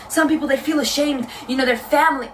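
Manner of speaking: imitating an American girl's accent; mock American accent. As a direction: parody her in an American accent. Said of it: "I don't know why I'm giving her this accent".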